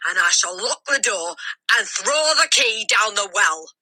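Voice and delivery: a bully tormenting her victim at full volume; furious shouting. A narrator's reading. Headmistress threatening the boy with the chokey, furious shouting. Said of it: "she shouted".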